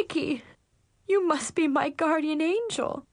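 a touch of happy tears; tearful gratitude. A narrator's reading. Shelter owner overwhelmed by the girl's help, tearful gratitude. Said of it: "became overwhelmed with emotion and suddenly burst into".

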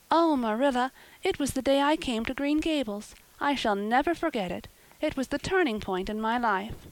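put-on high, earnest girl's voice; heartfelt girl. A narrator's reading. Anne recalling her first day at Green Gables, heartfelt. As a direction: gush as a grateful, happy girl.

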